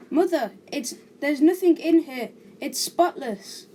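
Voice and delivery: put-on loud, high kid's complaint; calling out. A narrator's reading. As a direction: call through the house, exasperated. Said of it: "I call from down the stairs".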